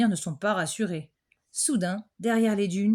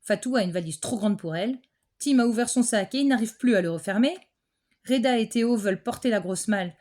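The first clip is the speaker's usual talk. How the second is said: plain reading voice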